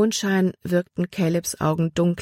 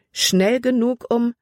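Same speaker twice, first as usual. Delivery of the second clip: higher-pitched, cut short after "um"; breaking off mid-sentence; 7 semitones higher than usual. A narrator's reading. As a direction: start the thought higher, then stop dead on "um". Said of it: "Libby hielt inne". (mitten im Satz innehaltend)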